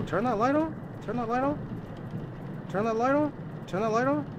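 high, rising squeak on each phrase; spooked, repeated high-pitched request; high-pitched pleading. Real, not acted. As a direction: plead upward in a small high voice.

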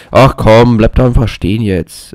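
loud, exasperated outburst; exasperated call. Real, not acted. Gamer telling the game to stand still, loud exasperation. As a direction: snap loudly at the game.

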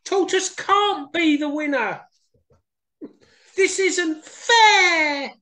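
high, slow, wailing protest; whining sore loser. Acted. Hare protesting her loss, high wailing whine. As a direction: whine that it's unfair.

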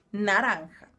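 "Naranja" with a throaty j; Spanish naranja. Shown as a demonstration. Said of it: "com o J de novo".

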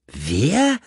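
long upward-sweeping question; incredulous question. A narrator's reading. Richard demanding the caller's name, incredulous. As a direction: slide up high in disbelief.

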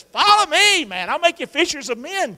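loud, high call of Jesus. Acted. Jesus calling the disciples, loud and high. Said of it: "Jesus says".

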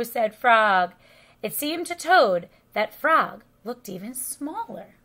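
plain reading voice

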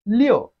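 "Liu" high and emphatic, then dropping; falling tone. Shown as a demonstration. Teacher models Chinese six for learners, falling "Liu". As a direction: punch "Liu" and let the pitch fall. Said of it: "It has the falling tone".

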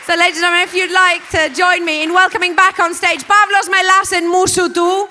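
loud, high host voice; rally the room for applause; stage announcement. Real, not acted. Host thanks Rosie on stage and announces her loudly to the audience. Host introduces the final act.

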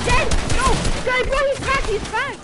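very high, loud yelling; frantic callout. Real, not acted. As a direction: shout urgently to the squad. Urgent callout in fight.

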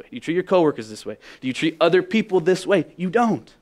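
ordinary talk, nothing marked

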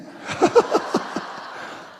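high, breathy real laugh; burst of laughter. Real, not acted. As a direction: laugh out at the memory. Laughing at wife's retort. (높게 터지는 웃음)

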